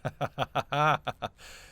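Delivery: loud chuckling burst; amused laugh. Acted. Amused reaction to the listener.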